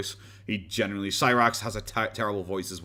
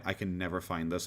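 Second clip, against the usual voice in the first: ordinary delivery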